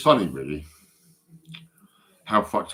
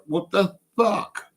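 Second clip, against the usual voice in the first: a much higher, slow "What the fuck?"; stunned disbelief at seeing his cap; shocked outburst; 12 semitones higher, slower than usual. Retold or imitated. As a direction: drag it out high in amazement. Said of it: "and I'm like".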